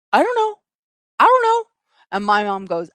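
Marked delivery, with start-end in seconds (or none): 0.1-1.6 put-on high-pitched small child voice; little child feigning innocence. Child denying theft to mom, squeaky innocence. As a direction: squeak out the denial like a kid.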